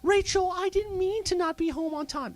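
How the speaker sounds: high mock apology to Rachel; mock impersonation. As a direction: whine it like a guilty excuse. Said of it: "That's kind of an impersonation".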